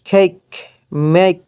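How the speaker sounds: two separate model words, "ay" in কেক and মেক. Shown as a demonstration. Teacher showing students A as in its name, "ay" in কেক, মেক. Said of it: "এ এর মতো হয়".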